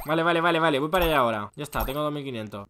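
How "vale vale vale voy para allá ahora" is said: normal talking voice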